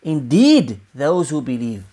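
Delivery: emphatic "Indeed", falling away after it; stress on INDEED. Shown as a demonstration. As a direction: punch "Indeed" and let the rest drop. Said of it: "it's more emphasized".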